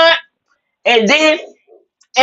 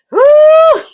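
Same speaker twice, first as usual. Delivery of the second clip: high loud whoop; a high cheering woo; excited woo; 4 dB louder than usual. Real, not acted. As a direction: let out a thrilled whoop. Cheering reaction.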